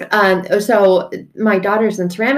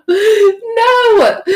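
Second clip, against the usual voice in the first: laughing no; 8 dB louder than usual. Acted. Cry-laugh refusal.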